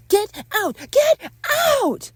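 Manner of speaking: very high, loud shouted command; angry shout. Acted. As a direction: scream each word separately. Ordering an intruder out.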